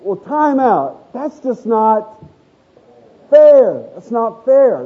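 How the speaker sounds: slow, drawn-out indignation building to "fair"; lightly hammed-up objection, stretching each word; acted protest. Passed-over worker objecting to lost promotion, indignant. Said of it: "And you're going".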